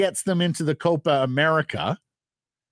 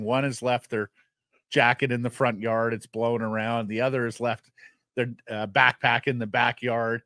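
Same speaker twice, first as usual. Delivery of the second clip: usual speaking voice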